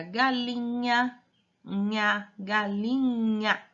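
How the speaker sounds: galinha stretched slowly, nha set apart; drawn-out reading demo. Shown as a demonstration. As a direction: draw out galinha and isolate its nha. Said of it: "como que a gente faz a leitura dessa sílaba". (galinha bem arrastado, destacando o nha)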